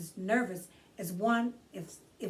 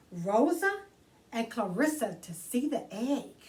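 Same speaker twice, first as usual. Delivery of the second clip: put-on bright read-aloud for children; gentle children's book narration; storybook reading; 2 semitones higher than usual. A narrator's reading. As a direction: read it like story time for kids. Reads picture book aloud.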